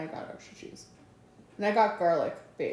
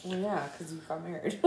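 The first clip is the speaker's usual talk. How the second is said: ordinary talk, nothing marked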